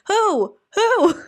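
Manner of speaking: high, loud hooting who; owl hoot. Shown as a demonstration. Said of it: "I do sound like an owl".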